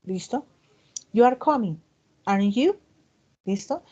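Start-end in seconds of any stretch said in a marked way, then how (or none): 1.1-2.8 "aren't you" rises at the end, seeking confirmation; rising tag question. Shown as a demonstration. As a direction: state it, then turn "aren't you?" upward to check. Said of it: "pues sube la entonación". (entonación ascendente en "aren't you?" para confirmar)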